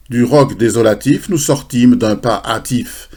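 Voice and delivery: loud, measured verse declamation; poem recitation. Acted. Presenter recites a poem about the château d'If, loud declamation. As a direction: declaim it to a hall, drawing out the rhymes.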